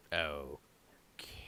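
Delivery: lightly voiced Tim, drawing out the puzzled okays; Tim, a confused loner. Game master as Tim, confronted in his private loft, quietly confused.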